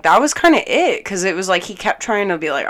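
normal talking voice

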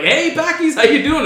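high-pitched cheery neighbor greeting; mock-friendly neighbor's teasing greeting; jokingly.

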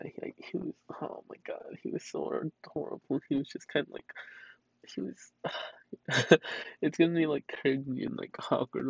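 soft, near whispered after crying; drained, quietly weepy; tearful, spent. Real, not acted. Owner says she still feels bad days later, quiet and tearful.

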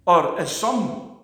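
ordinary delivery

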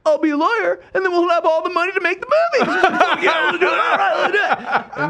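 high laughing pitch. Retold or imitated. Laughing excited retold pitch to director.

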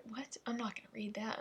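hushed, unvoiced whisper. Real, not acted. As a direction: whisper it like a secret aside. Declines to read a rude line.

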